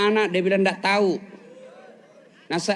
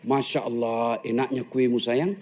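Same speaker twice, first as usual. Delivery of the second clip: low, soft, doting praise; sweet-talking husband; 9 semitones lower, 4 dB quieter than usual. Acted. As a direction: coo over the awful cake lovingly. Husband praising bad cake. (suami memuji kue dengan suara rendah dan lembut)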